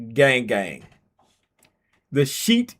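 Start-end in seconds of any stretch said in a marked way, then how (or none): none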